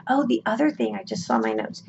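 ordinary talk, nothing marked

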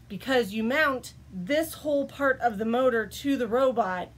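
ordinary talk, nothing marked

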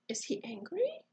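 plain reading voice

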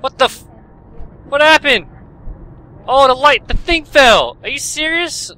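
high, loud, shocked yelps; startled outburst. Real, not acted. Ceiling light crashes onto the gamer, startled yelling. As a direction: yell in sudden alarm.

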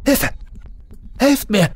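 very high cry for help; desperate cry for help. Acted. Trapped Markus calls into the canyon, very high desperate cry.

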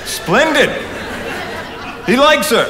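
high, gleeful through a laugh; laughing with delight. Acted. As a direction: laugh out the good news. Delighted at Henry's reaction.

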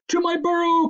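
high, loud cry of alarm; panicked cry. A narrator's reading. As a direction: cry out as if fleeing for cover. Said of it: "cried Huggledug".

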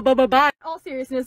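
usual speaking voice

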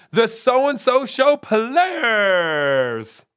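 grand announcer. Acted. Host announces the acting troupe.

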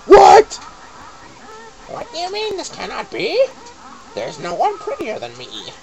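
high, shrill-voiced witch in disbelief; shrieking witch. Vain witch Gruntilda reading the game text, shrieking outrage. Witch hears of a prettier girl.

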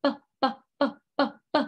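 "b" popped like bubbles; short, separate bursts of "b"; B sound. Shown as a demonstration. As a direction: pop out "b" in an even run. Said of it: "like when you're popping bubbles".

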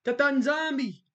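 a hint of a prayerful plea; reverent invocation. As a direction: call on Nzambi Ampungu in mock praise. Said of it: "He said".

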